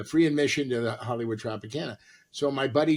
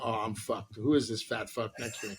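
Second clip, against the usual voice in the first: imitating her mutter; 5 semitones lower, 8 dB quieter than usual. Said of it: "she's sitting out the window going".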